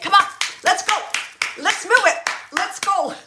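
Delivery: high, loud hurry-up shouts; impatient urging. Retold or imitated. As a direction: chivvy him along loudly. Woman hurrying slow man, acted.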